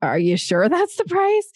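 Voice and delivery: high-pitched doubtful question; unsure questioning. Shown as a demonstration. Coach imitating an unsure seller, doubtful. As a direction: pitch climbs as if doubting your own price. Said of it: "and you sound like".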